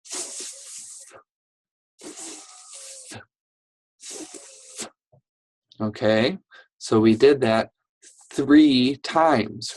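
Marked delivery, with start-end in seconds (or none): 0.0-4.9 a soft breathy "th-" with tongue between the teeth; just the airy "th-" alone, no vowel; th sound. Shown as a demonstration. Teacher drilling "th-" for kids and parents, soft and airy. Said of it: "And do".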